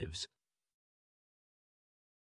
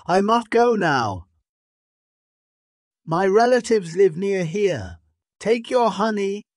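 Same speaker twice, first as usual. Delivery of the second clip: high, raised-voice calling; 12 semitones higher, 5 dB louder than usual. A narrator's reading. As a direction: keep calling out, pitched well up. Monkey calls out her farewell.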